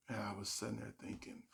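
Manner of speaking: ordinary talk, nothing marked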